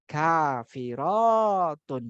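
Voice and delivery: very slow kafirotun with each syllable stretched; teacher spelling kafirotun out by sound; drawn-out model word. Shown as a demonstration. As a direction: stretch out kafirotun for students to copy.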